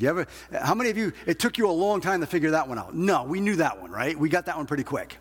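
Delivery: ordinary delivery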